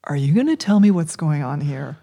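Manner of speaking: usual speaking voice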